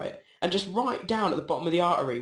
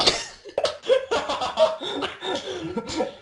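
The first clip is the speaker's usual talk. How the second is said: giggling fit; 13 semitones higher, 4 dB louder than usual. Real, not acted. Laughing at story's end.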